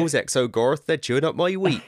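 high, loud West Country farmer; grumbling yokel farmer; West Country accent. Pretend.

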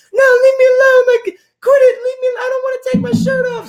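put-on very high, loud, whiny crying girly voice; girl voice crying. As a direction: wail it like a panicked girl. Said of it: "talking in the girl voice".

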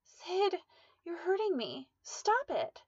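high, pleading cry to stop; frightened plea. Acted. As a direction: beg him in fear, voice high. Woman begs attacker to stop.

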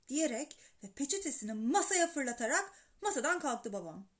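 plain reading voice

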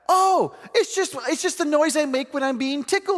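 lightly voiced six-year-old; child's voice. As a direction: play the innocent first grader. Child answering teacher.

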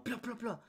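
three high "plo" pops; high, light mock gunfire; imitated gunshots. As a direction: mimic three shots with the mouth. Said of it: "sonó como un tres tiros".

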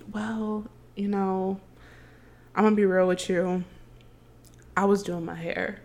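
soft, unhurried, laid-back confession; imitating chill date. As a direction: play him mellow and quiet, pausing between phrases. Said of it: "'cause he's really chill".

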